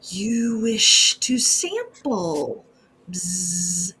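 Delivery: slow, breathy whispered insect voice, ending in a buzz; a graceful, menacing bee creature whispering slowly; menacing bee woman. Acted. Bee woman offers sample.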